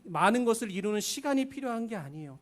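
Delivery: ordinary delivery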